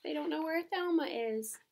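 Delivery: ordinary delivery, nothing marked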